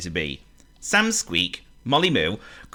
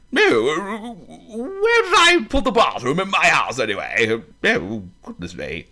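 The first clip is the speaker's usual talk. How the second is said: forgetful elephant; 9 semitones higher, 3 dB louder than usual. Acted.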